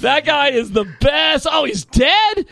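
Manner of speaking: high, hammed-up enthusiasm turning to mock surprise; gushing fan. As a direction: play a clueless, overexcited dude. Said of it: "You should've been like".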